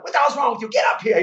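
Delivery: high, very loud berating; imitated hectoring shout; Don Rickles. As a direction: yell at the clumsy volunteer from the stage. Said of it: "He's just yelling, abusing me".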